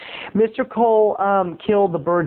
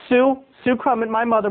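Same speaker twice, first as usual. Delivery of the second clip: ordinary talk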